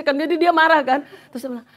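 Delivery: ordinary delivery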